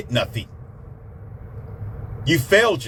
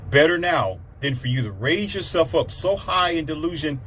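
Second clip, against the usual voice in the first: ordinary talk, nothing marked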